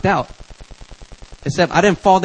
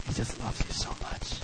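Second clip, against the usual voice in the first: very soft, low, near-whisper; hushed loving mentor voice; tender reassurance; 7 semitones lower, 16 dB quieter than usual. Acted. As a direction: comfort him in a hush.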